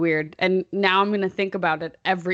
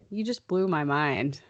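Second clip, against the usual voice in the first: normal talking voice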